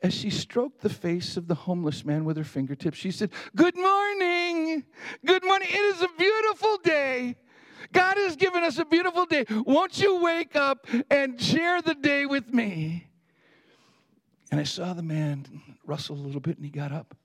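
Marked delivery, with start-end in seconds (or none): 3.5-13.1 high, slow, ringing wake-up call; clear sing-song. Retold or imitated. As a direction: sing out a morning greeting, drawing out the words. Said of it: "sing-songy voice".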